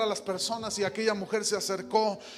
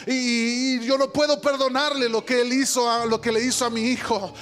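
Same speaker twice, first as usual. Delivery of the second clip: high, loud, impassioned sermon delivery; preaching at full force; 4 semitones higher, 6 dB louder than usual. Acted. Preacher telling the congregation of the drunk driver, impassioned and loud. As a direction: preach it, pouring out her anguish. Preacher retells grieving mother's words.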